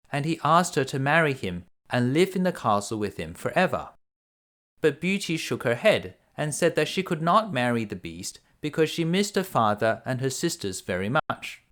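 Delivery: usual speaking voice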